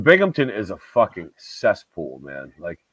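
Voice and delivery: ordinary talk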